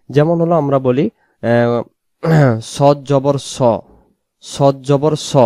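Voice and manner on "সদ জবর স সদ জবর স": স thick and rounded, drawn out slowly; heavy letter. Shown as a demonstration. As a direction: say সদ জবর স with a full "o" vowel. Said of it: "এটাকে মোটা করে পড়তে হয়".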